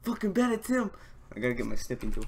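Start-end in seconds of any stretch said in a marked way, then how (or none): 0.0-0.9 excited. Real, not acted. Fanboy certainty.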